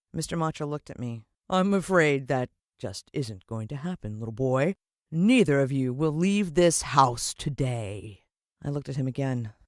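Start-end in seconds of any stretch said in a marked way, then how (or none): 1.5-8.2 slow, deliberate villain voice; sinister villain. Acted. Mister Motcher threatens the little boy, slow and sinister. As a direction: purr the threat, taking your time.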